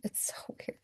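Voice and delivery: breathy whisper with a small laugh; whispered aside. Real, not acted. Telling viewers about family silence, whispered with a small laugh. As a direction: drop to a whisper, half laughing.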